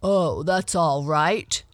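hint of a kindly bear. A narrator's reading. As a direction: reassure in a full, loud bear voice. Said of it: "said the bear kindly".

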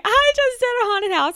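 high-pitched excited squeal; retold gleeful boast; proud announcement. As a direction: brag it in a high, giddy voice. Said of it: "how proud I felt of myself".

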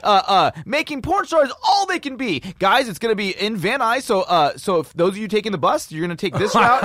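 loud, high hotline pitch; Jim Powers. Retold or imitated. Hotline host tells callers how to get there, hyped and loud. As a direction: imitate a hyped announcer giving directions. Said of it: "he was always really funny".

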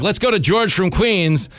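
high, slow on-air announcer intro; radio host voice. Retold or imitated. Host putting a caller on air, slow high announcer read. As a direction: throw to the caller like a DJ. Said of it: "And I was like".

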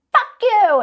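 high, loud shove-and-curse; aggressive insult. Acted. Player shoving an opponent, furious high shout. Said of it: "you're like".

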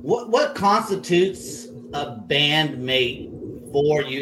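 ordinary talk

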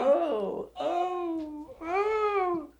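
high falsetto oh oh oh; mock moaning. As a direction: moan it up high like a joke. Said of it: "this is what it sounds like when I'm having sex".

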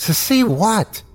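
high, bewildered question. Acted. Narrator to injured friend, bewildered. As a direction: at a loss, pitch shooting up. Baffled, frightened reply.

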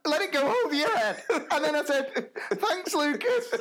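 high voice shaking with laughter; laughing. Real, not acted. Teacher retelling story, still laughing through it. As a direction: push words out through laughter.

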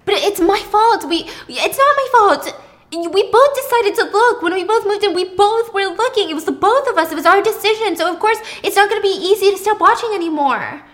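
high, loud, insistent arguing; heated self-defense. Acted. Pippa telling Thomas both of them looked, heated. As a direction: argue that the blame is shared. Acted quarrel over the watching.

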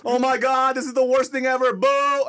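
high, loud jeering at the speaker; mock heckling. Guest reenacting heckling a drunk friend's long speech, loud and jeering. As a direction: boo him off the stage, hammed up. Retelling booing the wedding speech.

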